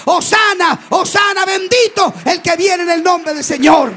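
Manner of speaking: high, loud, sing-song preaching at full force; impassioned sermon climax; fiery preaching. Acted. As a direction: proclaim it to the whole congregation. Preacher narrating miracle, full force.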